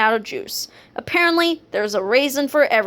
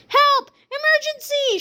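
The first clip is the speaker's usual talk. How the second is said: shouted for help. A narrator's reading. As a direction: yell it like the psychologist is raising the alarm. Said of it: "shouted the psychologist".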